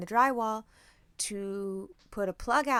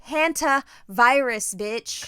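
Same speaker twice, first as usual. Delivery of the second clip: slow loud drawn-out warning; dramatic sassy declaration; 2 semitones higher, 8 dB louder, much slower than usual. Retold or imitated. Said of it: "'cause I'm like".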